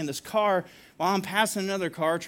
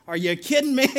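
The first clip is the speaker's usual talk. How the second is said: higher, louder mock outrage; retold indignation; 6 semitones higher, 5 dB louder than usual. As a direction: exclaim it in exasperated disbelief, retelling it. Retells anger at coworker cutting past.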